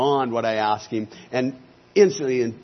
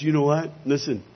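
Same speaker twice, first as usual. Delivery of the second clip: ordinary talk, nothing marked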